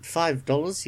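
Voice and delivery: normal talking voice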